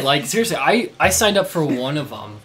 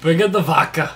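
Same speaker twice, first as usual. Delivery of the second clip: high, loud mock Russian-German accent; Russian accent turning German; 5 semitones higher, 7 dB louder than usual. Gamer calling for vodka, botched Russian accent. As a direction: try Russian, land half in German. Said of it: "like, a German accent".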